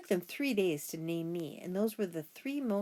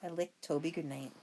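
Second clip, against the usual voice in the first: usual speaking voice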